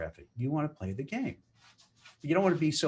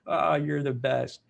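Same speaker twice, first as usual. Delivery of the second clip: fake laughing praise; 4 dB louder, slower than usual. Fake cheer at boss's joke.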